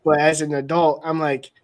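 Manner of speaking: ordinary talk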